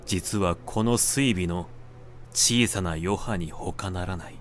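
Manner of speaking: plain reading voice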